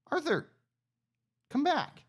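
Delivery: high call after a runaway pig. Real, not acted. Player calling the pet pig Arthur back, high call. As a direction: call him back from across the field.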